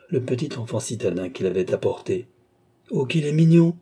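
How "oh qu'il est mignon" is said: raised, louder cry of admiration; a peasant woman gushing over a newborn; delighted exclamation. A narrator's reading. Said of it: "s'écria la paysanne".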